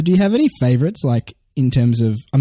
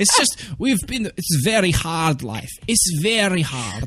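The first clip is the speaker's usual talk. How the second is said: slow, long-suffering Eastern European voice; Eastern European accent; 3 semitones higher, 4 dB quieter, slower than usual. Acted. Mocking generalisations about hard life, slow Eastern European accent. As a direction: lament slowly in a mock Eastern European accent. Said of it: "Eastern European".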